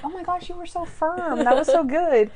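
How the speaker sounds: high-pitched imitated gushing praise; proud. Co-host plays Sharona praising Monk for standing up, proud gushing. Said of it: "Sharona was so proud of him".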